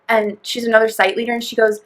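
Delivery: ordinary delivery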